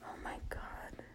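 barely audible whisper; whispered, retold shock; hushed disbelief. Retold reaction to upsetting moment.

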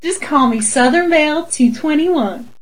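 slow, hammed-up Southern drawl; a bad cartoon Southern belle; Southern accent attempt. Canadian friend trying a Southern drawl, slow and overdone. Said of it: "I wanted to try your accent".

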